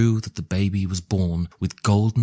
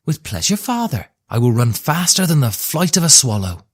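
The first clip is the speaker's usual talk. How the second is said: put-on much higher, bright girl's voice; eager young girl; 7 semitones higher, 5 dB louder than usual. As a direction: leap at the chance, sound like a young girl. Young daughter answers her father.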